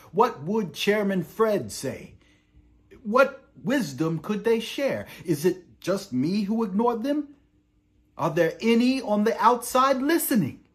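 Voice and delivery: high, loud, mounting questions; impassioned pleading. Acted. Prisoner calls on lost leaders for wisdom, loud impassioned plea. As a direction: build each question into a ringing cry.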